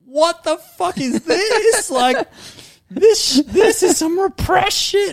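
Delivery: very high, loud, slow and laughing; high loud laughing falsetto; high laughing disbelief. Retold or imitated. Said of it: "And then I was like".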